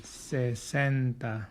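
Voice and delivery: very slow cuarenta, every syllable drawn out; slow model word. Shown as a demonstration. Teacher drills cuarenta for students, very slowly. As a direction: stretch cuarenta out for learners to copy. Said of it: "Say it with me".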